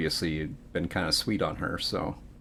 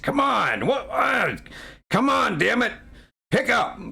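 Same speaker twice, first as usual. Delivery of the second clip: high-pitched, irritated shout at a machine; cranky old regular; 3 dB louder than usual. Acted. Game master voices forgetful Bob beating the TV, high irritated shouting. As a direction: yell like a frustrated, forgetful barfly.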